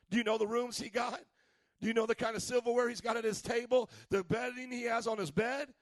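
loud sing-song preacher cadence; full-force preaching. Acted. Preacher pressing the congregation in a loud sing-song. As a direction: drive each question home to the crowd.

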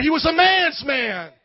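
slow, loud, every word hammered; shouted emphasis. Acted. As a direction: shout it like a battle cry. Pastor exalting Jesus' toughness.

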